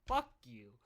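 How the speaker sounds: retort. Retold or imitated. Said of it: "And I go".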